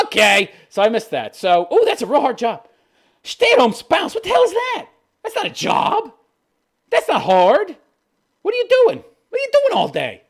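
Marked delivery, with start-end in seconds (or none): 3.2-10.2 high, loud incredulous demands; incredulous rant. Real, not acted. Ranting at the wife who calls it a job, high incredulity. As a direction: fire the questions at her.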